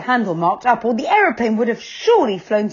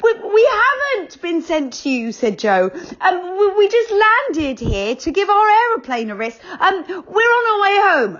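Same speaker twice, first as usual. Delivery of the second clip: put-on higher, stammering boy's protest; nervous Joe; 11 semitones higher, 3 dB louder than usual. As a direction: plead your innocence. Joe protests to Dame Snap.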